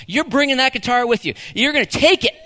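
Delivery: loud, laughing hype; overexcited parent. Retold or imitated. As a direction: get carried away with big dreams. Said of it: "A little too far".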